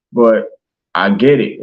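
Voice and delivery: ordinary talk, nothing marked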